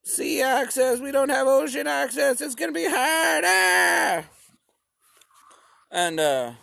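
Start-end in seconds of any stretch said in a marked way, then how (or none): 0.0-4.3 very high, fast fretting; panicky high mock voice. Ukrainians fretting about no sea access, high and fast. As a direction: rattle it off in a worried squeak. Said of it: "They were like".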